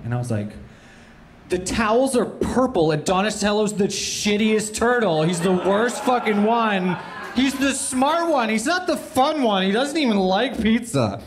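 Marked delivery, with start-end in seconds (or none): 1.5-11.2 high, wailing sobbed-out complaint, shouting in places; a crying kid's outraged meltdown, hammed up; bawling kid rant. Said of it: "I am absolutely bawling".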